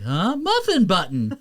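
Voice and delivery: dim-witted cartoon hero, high and slow; dopey, empty-headed impression; Goku. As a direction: say it slowly, cluelessly high. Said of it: "he's basically dumber than a box of hammers".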